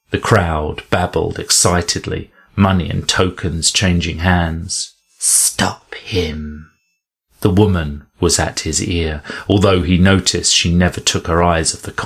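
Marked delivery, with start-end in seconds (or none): 5.2-6.7 hushed, urgent whisper at his ear. Acted. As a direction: whisper it so only he hears. Woman urging at his ear.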